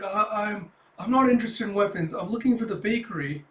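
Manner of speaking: high, stammered denial; flustered. Acted. Yor telling the saleslady she wants the bakery, flustered. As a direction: stumble in, then insist a bit loudly.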